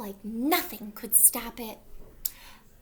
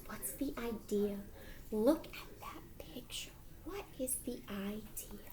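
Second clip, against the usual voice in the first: hushed prompt; 3 semitones lower, 8 dB quieter than usual. Real, not acted. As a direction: lean in and whisper the question. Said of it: "prompting children softly".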